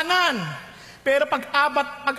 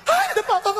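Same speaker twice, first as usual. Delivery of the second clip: fussing parent; 12 semitones higher, faster than usual. Retold or imitated. Preacher mocking parents who fuss when a child falls, high mock alarm.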